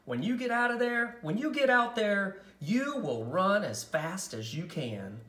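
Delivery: a lightly voiced mother urging her on; encouraging mother. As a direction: cheer her on before the race. Mother encourages nervous daughter.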